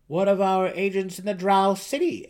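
an uneasy advisor pressing the king; worried gnome counselor. Acted. Counselor asks about agents.

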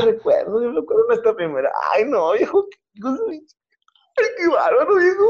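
high, hammed-up sentimental old lady; mock tearful old woman reminiscing; mock emotional old wife. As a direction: gush over the memory like a moved old wife. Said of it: "Bien emocionada".

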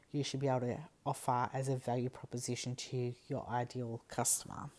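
usual speaking voice

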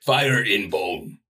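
slow, simple brute talk; caveman voice. Acted. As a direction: drag it out like a caveman. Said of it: "That's a good caveman voice".

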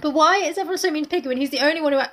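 ordinary talk

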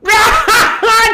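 loud, high imitated guffaw; explosive mock cackle; bursting laugh. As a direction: burst out laughing like the tactless buddy. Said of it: "just looks and goes".

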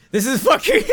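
high, loud, breaking into laughter; real laughter overtaking the words; cracking up. Real, not acted. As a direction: lose it laughing mid-sentence. Cracks up at his own slip.

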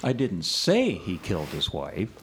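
"say" stressed with a rise. Shown as a demonstration. Her turn in the emphasis game, stress on "say". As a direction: lift and weight "say" only. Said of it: "put the inflection on the second".

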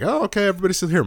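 high and rushed, a little startled; mock surprise. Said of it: "I'm like".

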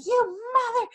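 put-on yelling at her laughing boyfriend, outraged; mock outrage. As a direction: rail at him, scandalised. Furious rant at boyfriend.

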